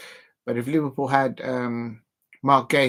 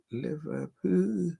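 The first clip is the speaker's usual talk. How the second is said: soft, very slow Scouse sounding-out; attempted Scouse accent; 3 semitones higher, 6 dB quieter, much slower than usual. Shown as a demonstration. Fan rehearsing the name to himself, careful Scouse accent. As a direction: feel out the Scouse vowels slowly and softly. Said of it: "a Scouser's accent".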